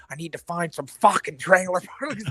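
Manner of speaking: a hint of the Trailer Park Boys character; quick jokey Bubbles voice; Bubbles impression. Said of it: "sounds too much like Bubbles".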